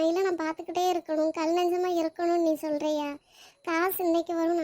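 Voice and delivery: ordinary delivery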